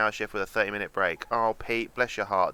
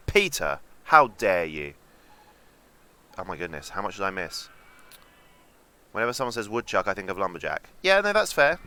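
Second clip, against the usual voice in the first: usual speaking voice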